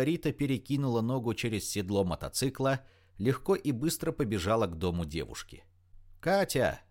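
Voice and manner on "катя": raised, higher call to a friend. A narrator's reading. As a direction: call up to her window. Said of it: "закричала она".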